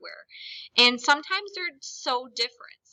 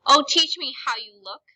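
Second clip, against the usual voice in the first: begging; 4 semitones higher, 7 dB louder than usual. Shown as a demonstration. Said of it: "is begging".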